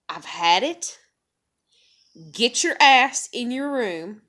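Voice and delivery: loud, slow, firm scolding; punched, exasperated mom order; retold fed-up parent. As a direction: re-enact ordering a kid away. Scolding own child, retold.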